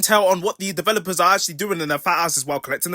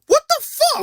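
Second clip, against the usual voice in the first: shocked; 5 dB louder, slower than usual. Real, not acted. Shocked by clip.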